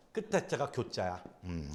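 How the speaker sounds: ordinary delivery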